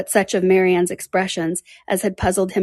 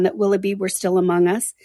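ordinary delivery, nothing marked

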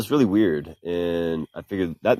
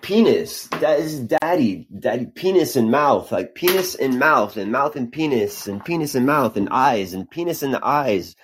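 put-on higher-pitched sing-song child quote; retold child's chant, pitched up; imitating little girl; 5 semitones higher than usual. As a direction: lightly mimic a small girl repeating herself. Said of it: "this little girl is drawing a picture".

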